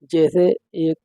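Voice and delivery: usual speaking voice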